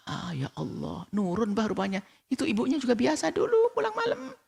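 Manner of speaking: high, softened scandalised voice; gossiping wife. Acted. Wife hearing of the neighbour's daughter, high gossipy dismay. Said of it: "Istrinya dengar itu langsung".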